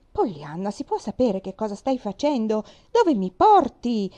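aunt pulls back, loud and protesting, last words slowed right down; sharp, rising objection from a flustered aunt; alarmed protest. A narrator's reading. Aunt resisting being steered to the veranda, loud protest.

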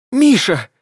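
a high, loud shriek of horror; terrified shriek. A narrator's reading. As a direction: scream the name in panic. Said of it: "взвизгнула Таня с ужасом".

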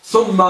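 ثم said as "thumma", with a u vowel; damma on the tha. Shown as a demonstration. Teacher explaining the conjunction, ثم with u as "thumma". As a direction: say ثم as "THUM-ma". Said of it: "بالضم".

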